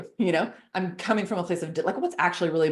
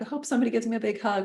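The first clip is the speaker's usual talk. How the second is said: wistful wishing; 5 semitones higher, faster than usual. Retold or imitated. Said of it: "waiting around being like".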